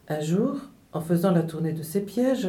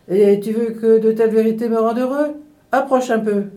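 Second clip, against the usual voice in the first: loud, raised indignant challenge; indignant exclaiming; 4 semitones higher, 8 dB louder than usual. A narrator's reading. Peasant challenging the nightingale's useless truths, loud indignation. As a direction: call the bird over in exasperation. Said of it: "s'exclama le paysan".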